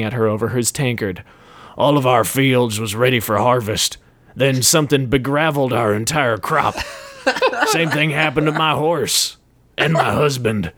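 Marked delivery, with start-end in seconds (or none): 1.8-10.7 a hint of a surly farmer. A narrator's reading. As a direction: grumble like a yokel. Mustachioed farmer speaks.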